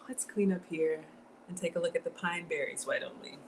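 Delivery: hushed whisper while tidying; soft unvoiced aside. Real, not acted. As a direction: whisper it, almost to yourself. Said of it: "Forgive me".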